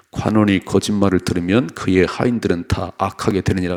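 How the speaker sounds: loud, steady unison reading of the verse; scripture read aloud. Real, not acted. Pastor leads the congregation in reading, loud and steady. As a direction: read the proverb out together, loud and even.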